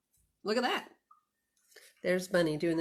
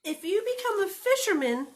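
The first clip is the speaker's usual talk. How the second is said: high, loud little bunny; 13 semitones higher, 5 dB louder than usual. Acted. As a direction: play the runaway bunny, bright and eager. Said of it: "said the little bunny".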